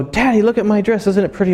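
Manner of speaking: little daughter's voice. Retold or imitated. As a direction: play a small girl showing off her dress. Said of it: "she'll go".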